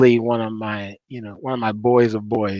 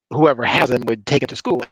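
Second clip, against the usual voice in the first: ordinary talk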